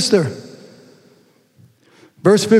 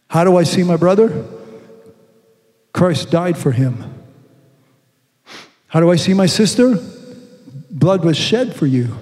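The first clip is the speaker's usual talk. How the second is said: ordinary delivery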